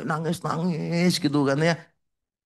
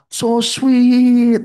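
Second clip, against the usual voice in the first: high, loud gushing so sweet; cooing angels; 8 semitones higher, 8 dB louder than usual. Retold or imitated. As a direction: coo it like delighted angels.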